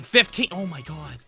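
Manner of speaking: usual speaking voice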